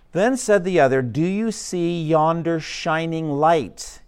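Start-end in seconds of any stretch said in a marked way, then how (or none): 1.0-4.0 slow evangelist asking the pilgrim; slow, drawn-out question. Acted. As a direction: ask it very slowly.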